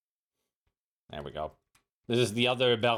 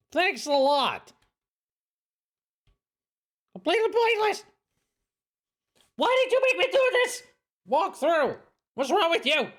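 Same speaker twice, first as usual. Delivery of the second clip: Mickey Mouse voice. Real, not acted. High squeaky mocking complaint.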